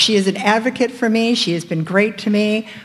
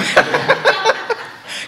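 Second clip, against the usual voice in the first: breathy nervous laugh; airy laugh; 12 semitones higher than usual. Real, not acted. Nervous laugh about job.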